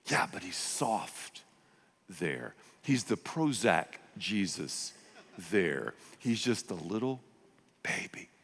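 slow, soft cooing. Acted. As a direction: coo over a sleeping baby. Cooing over baby Jesus.

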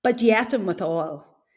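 ordinary talk

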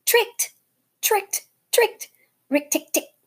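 very high gleeful crowing; triumphant chuckle. Acted. Rikki gloating after tricking Nagina, very high. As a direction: crow in triumph. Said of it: "chuckled Rikki Tikki".

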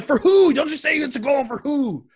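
high, loud impatient questioning; excited demand. Retold or imitated. Father in car quizzing his daughter, loud and excited. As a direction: press her hard for which team scored.

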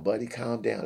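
usual speaking voice

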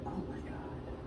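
breathless whisper of disbelief; hushed and stunned by what happened; whispered shock. Retold or imitated. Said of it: "And she said".

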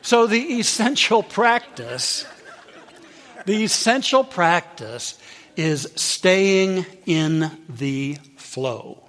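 slow, drawn-out teaching point; slow emphasis. Real, not acted. Preacher stressing the key practice, slowly. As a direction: lean on each word. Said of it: "slow emphatic teaching".

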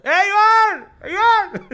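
very high, loud call to someone far off; imitated crowd shouting. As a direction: yell like a fan calling from the queue. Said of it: "y empiezan a gritar". (gritos agudos imitando a la gente)

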